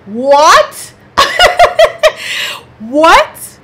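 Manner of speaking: high, loud what with a laugh; shocked and amused outburst; incredulous shout; loud disbelief. Real, not acted. CPS showed up at her house.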